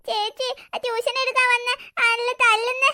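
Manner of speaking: slow, sobbing complaint about a teacher; crying child. Acted. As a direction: tell on the teacher in a drawn-out wail. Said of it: "പിന്നെന്തിനാ നീ കരയുന്നേ അപ്പുകുട്ടാ". (കരഞ്ഞുകൊണ്ട് വലിച്ചുനീട്ടി പരാതി)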